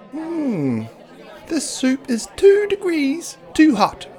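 fussy meter maid peeping about her soup. Acted. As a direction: peep it like a fussy bird. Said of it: "she peeped".

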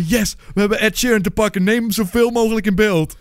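high, excited acted thought; hammed-up delight, pitched well up; mock triumphant glee. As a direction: play the makers crowing over their catch. Acts the makers' gleeful thinking.

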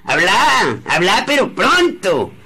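loud, high, impatient bark; impatient shout. Acted. Old man shouting at the nephew to hurry, impatient. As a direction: demand he spit it out now.

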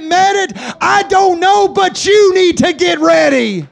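from steady to loud high preaching; building to a shout. Acted. Preacher rising to warn the church, building to a shout. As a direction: climb until you're shouting.

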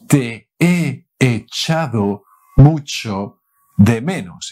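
ordinary delivery

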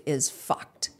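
low, soft, almost voiceless; hushed swear. Real, not acted. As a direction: drop to a near whisper. Lowers voice on the swear word.

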